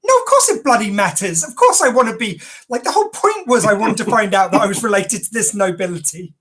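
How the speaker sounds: imitating an openly put-out guest, pitch lifted; mock outraged straight talk; blunt indignation. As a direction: protest bluntly, admitting it matters. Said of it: "He just straight up, he was like".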